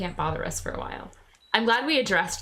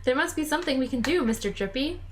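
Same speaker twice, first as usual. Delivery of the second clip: put-on gentle young Oliver, a little sad; lightly voiced young boy; boy hero voice; 8 semitones higher than usual.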